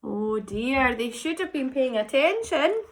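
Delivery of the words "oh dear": usual speaking voice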